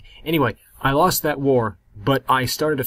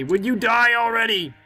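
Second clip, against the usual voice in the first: exasperated yell; 8 semitones higher than usual. Real, not acted. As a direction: demand it in frustration. Impatient at a living king.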